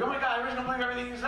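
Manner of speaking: 1 mocking wife.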